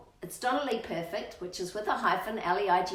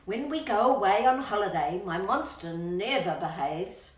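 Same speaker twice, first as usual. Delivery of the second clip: bouncy rhyming read-aloud, louder on the gross bits; reading to children; 5 dB louder than usual. Acted. Reading the birthday verse to Rocky, bouncy and relishing. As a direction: savour every disgusting detail for the child.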